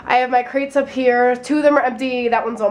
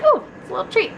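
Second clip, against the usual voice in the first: light high delighted voice; high sing-song treat voice; 10 semitones higher, much faster than usual. Retold or imitated. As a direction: say it like a treat to yourself. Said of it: "is like".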